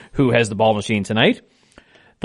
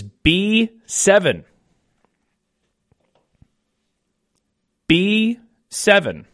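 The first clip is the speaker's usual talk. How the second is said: slow, deliberate number calling; bingo caller; slower than usual. Acted. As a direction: call each ball slowly with falling ends. Calls each bingo ball twice.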